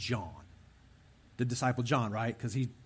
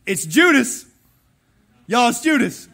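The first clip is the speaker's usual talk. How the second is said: loud, high shout outing the culprit; hammed-up shouted accusation; mock outburst; 9 semitones higher, 14 dB louder than usual. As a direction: yell it across the table as a comic reveal. Joking shout as Jesus outing Judas.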